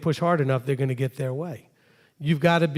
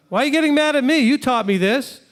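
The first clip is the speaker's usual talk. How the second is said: raised, higher voice in protest; indignant, raised plea to mother; upset protest; 9 semitones higher, 4 dB louder than usual. Pretend. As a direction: plead loudly with Mom why she's mad. Acted quarrel with mother.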